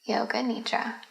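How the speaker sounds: guided meditation. Real, not acted.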